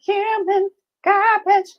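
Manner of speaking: slow high human garbage; high falsetto quote. Retold or imitated. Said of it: "Nobody knows mine?".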